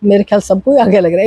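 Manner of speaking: normal talking voice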